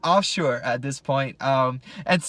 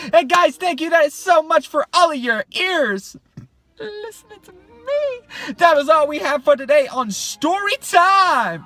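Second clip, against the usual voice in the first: high silly outro voice; 12 semitones higher, 5 dB louder than usual. Acted.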